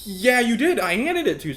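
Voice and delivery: high, loud mock protest; exasperated insistence. Student insisting to the lying classmate, high and pushed. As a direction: insist like a frustrated kid. Arguing with the liar over card.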